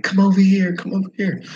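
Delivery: high beckoning call; imitated local calling out; calling him over. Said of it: "And they're like".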